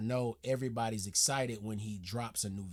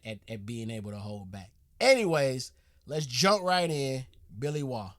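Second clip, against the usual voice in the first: usual speaking voice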